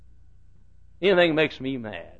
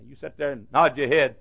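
usual speaking voice

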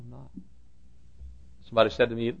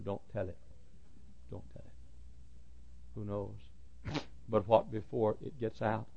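very soft, confidential preaching; hushed plea; 13 dB quieter than usual. Real, not acted. Preacher urging people not to tell, hushed plea. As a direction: drop right down and urge secrecy.

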